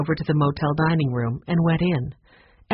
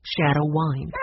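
ordinary delivery

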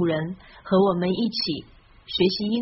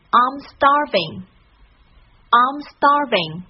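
slow and louder, "starving" leading; slow model reading; 4 semitones higher, 5 dB louder, much slower than usual. Shown as a demonstration. Teacher reads the sentence twice for listeners to follow. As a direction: read it slowly and loudly for repeating. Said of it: "再跟大米一起把这句话读两遍吧".

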